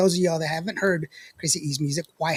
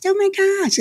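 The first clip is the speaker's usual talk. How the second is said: much higher, louder squeaky voice; high pitch mimic; 6 dB louder, slower than usual. Mocking husband Jonathan's reaction, high squeal. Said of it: "with a high pitch".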